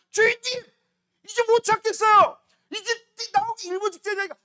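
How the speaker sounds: high, loud urgent plea; desperate bladder. Acted. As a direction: cry out as if about to burst. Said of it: "주인님한테 신호를 보내죠". (터지기 직전 방광의 다급하고 높은 외침)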